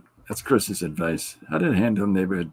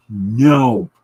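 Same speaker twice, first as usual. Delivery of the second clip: firm no; 9 dB louder than usual. Retold or imitated. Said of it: "you say".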